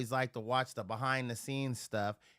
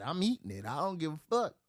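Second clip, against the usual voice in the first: normal talking voice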